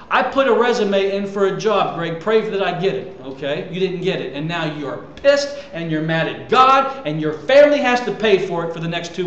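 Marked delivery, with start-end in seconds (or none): none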